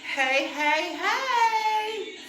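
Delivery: ordinary talk, nothing marked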